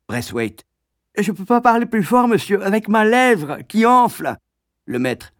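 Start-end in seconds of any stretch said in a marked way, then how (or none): 1.2-4.4 very high, distorted pupil voice; stung schoolboy; swollen-lip boy. Acted. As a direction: speak past a fat lip.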